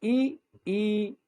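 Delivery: ई once short, then ई held long. Shown as a demonstration. Teacher demonstrating vowel length to students, short then long. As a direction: say ई quick, then stretch ई out. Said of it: "फिर से देखिए".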